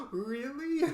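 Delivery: high, amazed really; mock stunned surprise; surprised. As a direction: gasp then ask in delight.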